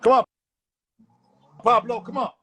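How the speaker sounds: loud, high call to the dog. Real, not acted. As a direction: call Pablo across the yard. Calling the dog again.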